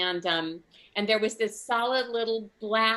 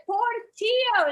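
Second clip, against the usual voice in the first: Portillo repeated much higher, slow and emphatic; insistent repeat; 4 dB louder, slower than usual. Retold or imitated. Comic repeats the dog's name to puzzled painters, slower and insistent. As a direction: spell it out to the deaf painters. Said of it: "And I said".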